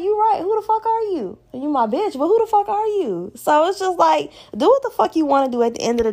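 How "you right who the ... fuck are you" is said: high-pitched, playful mock retort; raised playful put-down; high mock agreement. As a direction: toss it back high and teasing. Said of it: "I'm like".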